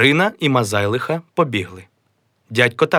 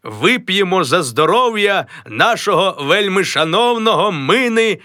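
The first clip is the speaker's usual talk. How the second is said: high, solemn toast; a high, pompous toast; grand toast; 9 semitones higher than usual. Acted. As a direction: raise a glass to yourself. Mazailo toasts himself.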